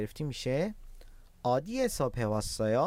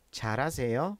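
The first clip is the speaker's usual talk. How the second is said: normal talking voice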